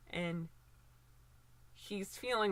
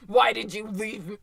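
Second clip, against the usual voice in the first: loud, accusing put-on cry; mock angry son; 10 dB louder than usual. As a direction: hurl it at the mother like a wounded son. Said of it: "who is like".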